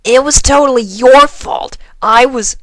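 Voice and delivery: high loud indignant retort; arguing back. Acted. Rainbow snapping back at Twilight, loud indignant retort. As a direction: fire it back at her, outraged. Couple starts to argue.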